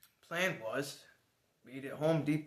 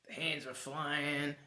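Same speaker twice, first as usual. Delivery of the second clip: ordinary talk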